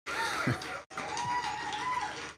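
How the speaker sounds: a high, sudden shriek of laughter; real shrieking laugh. Real, not acted. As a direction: burst out in a squealing laugh. Explosive laugh after the spin.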